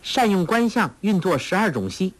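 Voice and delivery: put-on girl reciting a translation. Girl feigning a translation for the villain, high and recited. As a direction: pitch it high, like intoning verse.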